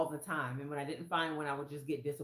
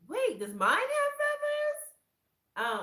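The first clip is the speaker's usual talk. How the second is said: much higher, louder and slow; surprised squeak; 10 dB louder, much slower than usual. Retold or imitated. Checking her own shawl, high and eager. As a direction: gasp of sudden hope.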